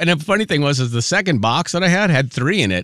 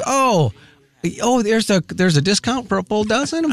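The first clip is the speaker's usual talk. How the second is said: higher-pitched voice for the store clerk; high, halting retold question; imitated checker; 8 semitones higher than usual. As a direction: raise the pitch to play a surprised cashier. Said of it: "she goes".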